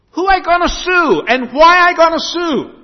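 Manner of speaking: high bewildered shout in broken English; baffled shout. Retold or imitated. Husband shouting his confusion at the lawyer's office, high and baffled. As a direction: cry out, utterly confused by the advice.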